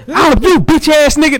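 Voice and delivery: high-pitched mock outburst. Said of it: "sound like [name]".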